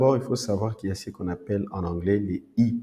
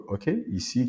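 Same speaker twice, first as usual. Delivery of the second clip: ordinary talk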